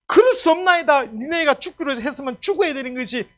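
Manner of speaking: high, loud prophet's refusal; defiant objection. Acted. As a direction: object forcefully to God. Acts Jonah refusing God's change.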